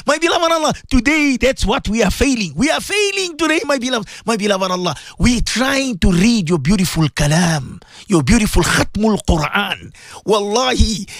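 normal talking voice

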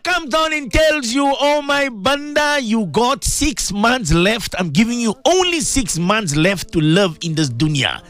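slow, drawn-out proclamation, starting high and gradually descending; high, slow sermon cadence easing down; solemn preaching. Acted. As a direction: proclaim God's warning with long, weighty words. Preaches God's warning of six months.

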